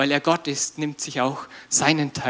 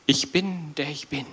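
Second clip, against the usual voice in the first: solemn divine quote; 6 dB quieter than usual. Retold or imitated. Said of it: "Und er sagte ihm".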